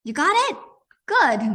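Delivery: high eager encouragement. Acted. Encouraging children.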